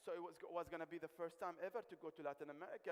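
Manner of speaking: ordinary delivery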